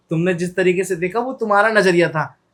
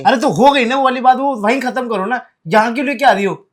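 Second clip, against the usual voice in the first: raised protest; 5 semitones higher, 5 dB louder than usual. Acted. Husband protests in quarrel.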